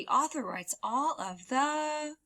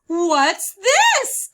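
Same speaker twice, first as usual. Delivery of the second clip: loud high surprised "What's this?"; a little boy finding a new book; eager child voice; curious question; 9 semitones higher, 12 dB louder than usual. Acted.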